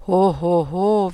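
loud ho ho ho, hearty Santa; jolly Santa laugh. Acted. Nikolaus answers the boy with a hearty laugh.

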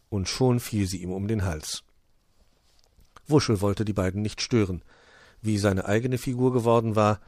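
plain reading voice

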